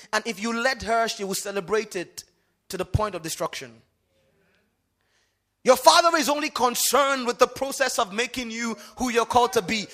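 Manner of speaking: full-force preaching. Real, not acted. Preacher contrasting mothers and fathers, full-force preaching. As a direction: drive each phrase home loudly to the congregation.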